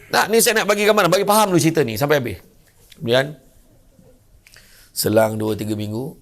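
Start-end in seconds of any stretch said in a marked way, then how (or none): none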